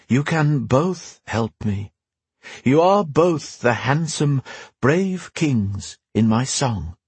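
slow, seductive forest spirit; enchantress voice. Acted. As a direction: draw them in slowly, enticing. Enchantress invites both men.

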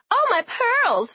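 slow, high pearl-clutching gasp; feigned surprise. Retold or imitated. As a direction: clutch your pearls, mock shocked. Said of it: "feigned surprise yelling".

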